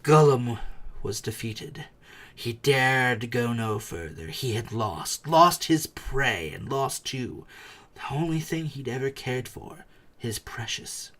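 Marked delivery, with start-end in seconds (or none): none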